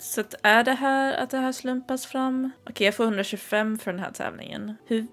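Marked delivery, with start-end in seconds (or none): none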